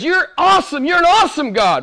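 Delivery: fervent praise. Acted. Preacher voicing worship to God, exultant. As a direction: exult in the words. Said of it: "This is what the prayer is".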